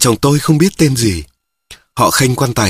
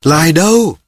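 short, higher-pitched summons; a raised narrated shout for someone; calling out; 6 semitones higher than usual. A narrator's reading. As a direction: call for her across the tables. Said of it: "Mâm nào cũng gọi".

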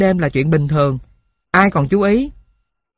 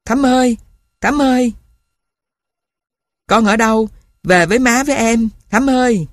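higher, calling out through sobs; a mother searching in the night, wailing her name; crying call; 5 semitones higher than usual. A narrator's reading. Mother searching for her lost daughter, crying call. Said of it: "vừa kêu vừa nghêu ngao khóc".

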